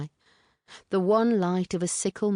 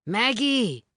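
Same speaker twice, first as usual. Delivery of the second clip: higher, raised call; 8 semitones higher, 4 dB louder than usual. A narrator's reading. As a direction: call her name out again. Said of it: "He raised his voice again".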